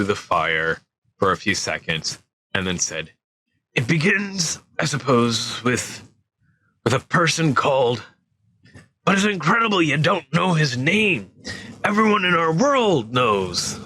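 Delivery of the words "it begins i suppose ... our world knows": lightly voiced gentle giant, pitched higher, no southern accent; hesitant giant reluctant to begin, voice lifted; nervous storyteller giant, higher; Hagrid voice. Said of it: "trying not to do the southern accent".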